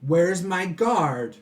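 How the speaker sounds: slow, loud demand; yelling villain. Acted. Villain summoning his guard, slow indignant yell. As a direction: call out imperiously for the guard. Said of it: "He yells at everybody".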